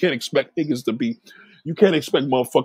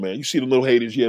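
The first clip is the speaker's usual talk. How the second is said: ordinary talk, nothing marked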